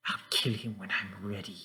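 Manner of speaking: hushed, half-whispered muttering; whispering to himself. Acted. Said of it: "slowly starts whispering stuff".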